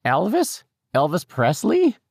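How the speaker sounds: put-on high, drawn-out questioning old lady; incredulous, high-pitched character voice; puzzled old woman. A narrator's reading. Old woman puzzled by his name.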